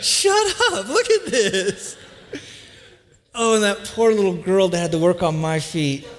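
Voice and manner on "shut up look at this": high-pitched, drawn-out mock amazement; amazed outburst. Man discovering power tools at nail salon, hammed-up amazement. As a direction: squeal it high, then stretch out the marvelling.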